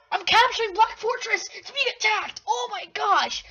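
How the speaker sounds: high, loud gamer panic; alarmed outcry. Real, not acted. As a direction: cry out as the attack hits. Suddenly attacked in the game.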